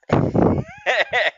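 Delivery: a big burst of real laughter, high and loud. Real, not acted. Laughing hard at a dying villain's last lines. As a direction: laugh out loud at the funny death scene. Laughing at a killer's final words.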